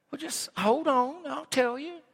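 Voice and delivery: high, soft, teasing aside; sing-song wait-for-it tease; playful teasing. Real, not acted. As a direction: hold them off with a playful tease. Teasing congregation mid-reading.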